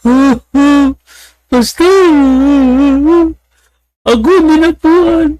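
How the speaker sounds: high, loud, panting man; breathless mock confession. Bald man telling the pastor the handkerchief chose him, high and panting. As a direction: gasp it out as if seized. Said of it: "sabi ni Kalbo".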